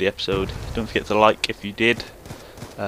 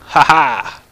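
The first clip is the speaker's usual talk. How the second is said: loud mocking laugh then jeer; gloating taunt; 4 dB louder than usual. Real, not acted. Gamer gloating over killed enemy Webbo, loud taunt. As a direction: rub it in his face.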